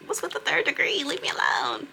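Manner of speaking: high squeaky indignant jab; mock indignant. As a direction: play it as an exaggerated offended squeal. Snapping at nosy coworker.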